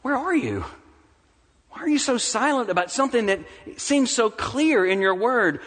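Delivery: high, insistent challenge to God; impassioned acted prayer of doubt; anguished questioning. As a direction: press God for an answer. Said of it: "you begin to wonder".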